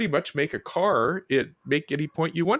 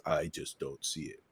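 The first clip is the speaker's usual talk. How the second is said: low, soft cartoon voice; deadpan low answer in character; second cartoon character; 9 semitones lower, 9 dB quieter than usual. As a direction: drop deep and quiet for the partner's reply. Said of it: "And the other one says".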